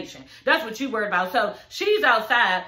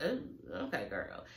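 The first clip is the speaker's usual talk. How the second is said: sarcastic aside; 15 dB quieter than usual. Retold or imitated. Mock reaction to Jackie's news.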